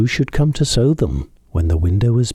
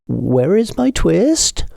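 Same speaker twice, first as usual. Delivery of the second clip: higher, slow pleading question; anxious old tailor; 11 semitones higher, much slower than usual. A narrator's reading. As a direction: plead slowly with the cat. Tailor asks cat for twist.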